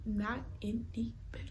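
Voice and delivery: hushed repeat for effect; soft, voiceless emphasis; emphatic whisper. Real, not acted. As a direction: whisper it, each word spaced out.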